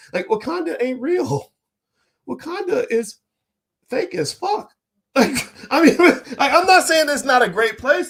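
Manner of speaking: high-pitched, laughing through the words; real laughter. Real, not acted. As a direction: crack up while insisting on the point. Laughing at his own blunt remark.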